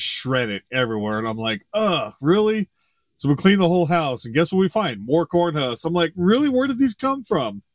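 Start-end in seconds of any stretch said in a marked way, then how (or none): 1.7-2.7 higher-pitched, fed-up groan; exasperated. Retold or imitated. Owner finding shredded garbage, exasperated. Acting out exasperation at dogs' mess. 6.2-7.6 high, incredulous questioning; exasperated disbelief. Retold or imitated. Owner finding more husks after cleaning, exasperated disbelief.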